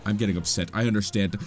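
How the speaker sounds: normal talking voice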